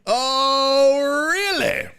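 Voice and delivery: high, drawn-out "oh really"; mock disbelief. Host doubting Clarence is working, mock disbelief.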